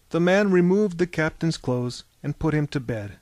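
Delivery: ordinary delivery, nothing marked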